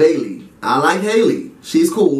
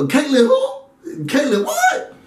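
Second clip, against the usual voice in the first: mock confusion; 9 semitones higher, slower than usual. Real, not acted.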